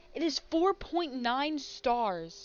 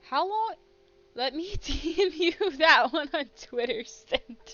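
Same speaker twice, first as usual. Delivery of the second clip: loud, high amazed outburst; real shock; 4 semitones higher, 7 dB louder than usual. Real, not acted. Streamer shocked the fnaf movie is a podcast, loud amazed. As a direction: blurt it out in disbelief.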